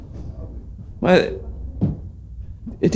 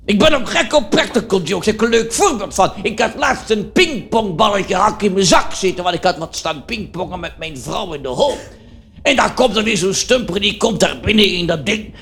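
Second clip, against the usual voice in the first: raised-pitch cheerful comic character voice; Dirk Dickenberg character; 4 semitones higher than usual. Acted. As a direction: play the tactless joker Dirk, pitched up. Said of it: "dat is een typetje".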